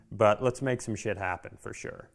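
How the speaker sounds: usual speaking voice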